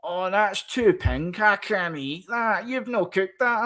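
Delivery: hammed-up old lady complaint; mock moaning nana. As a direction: whine like a grandma who won't eat pink steak. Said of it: "She's like".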